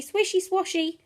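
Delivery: plain reading voice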